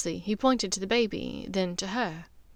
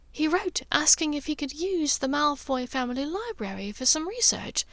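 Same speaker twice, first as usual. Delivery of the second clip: higher motherly voice, kindly teasing her son; Narcissa, gentle mother; 5 semitones higher than usual. Acted. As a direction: play the caring mother checking on her son.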